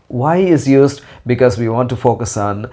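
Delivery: ordinary delivery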